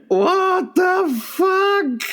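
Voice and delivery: high, very slow what the fuck; drawn-out shocked reaction. Retold or imitated. Reacting to the bar girl's remark, slow high disbelief. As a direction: stretch out the disbelief. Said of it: "I went".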